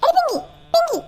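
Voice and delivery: very high, loud yell; yelling to wake someone. Acted. Impatient at a sleeper who won't get up, high yelling. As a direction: shriek the name to rouse them. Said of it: "അത്ര ഒച്ചത്തിൽ വിളിച്ചേ".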